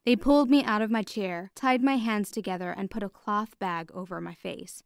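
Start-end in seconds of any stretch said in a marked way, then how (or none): none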